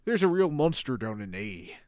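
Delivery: fading Devon accent. Shown as a demonstration. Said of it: "now I'm losing my Devon".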